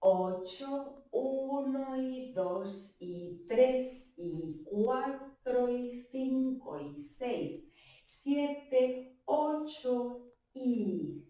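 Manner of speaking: very slow, drawn-out dance count. Shown as a demonstration. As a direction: stretch every number and link them with a long "y". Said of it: "Lo hacemos un poquito más lento".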